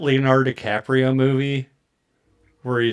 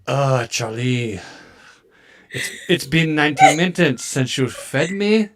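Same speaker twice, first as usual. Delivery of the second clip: a hint of a snooty French cat, slow; lightly put-on French accent; cat complaining to Charlie in mock French; absurd French accent; 3 semitones higher, slower than usual. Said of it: "absurd French".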